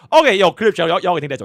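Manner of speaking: loud, high hype call to chat; loud callout. Real, not acted. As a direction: shout it out to the stream. Streamer calls out to chat.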